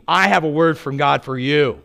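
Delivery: mock haughty voice of a patronizing roommate; demeaning. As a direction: talk down to him like a superior.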